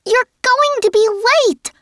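high, loud, squeaky warning; squeaky counselor. A narrator's reading. Counselor Sari warning the girls they're late, high squeak. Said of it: "high-pitched and squeaky".

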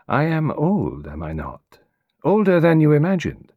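a lightly voiced foreign detective, amused and probing; Hercule Poirot, teasing. Poirot teasing his young client, lightly voiced. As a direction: play the old detective gently testing his visitor.

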